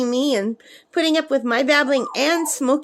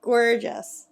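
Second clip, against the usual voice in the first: ordinary delivery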